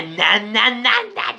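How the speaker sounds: put-on high, light baby babbling sounds; baby babble imitation. Imitating his toddler's babbling, high and playful. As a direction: coo like a little kid babbling. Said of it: "doing things like".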